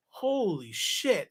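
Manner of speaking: slow, drawn-out amazement; retold awe. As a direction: stretch both words out, stunned. Retold awe at walls of comics.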